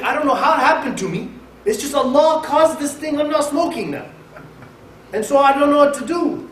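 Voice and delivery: high-pitched mock helplessness; helpless excuse. Acted excuse of a helpless sinner.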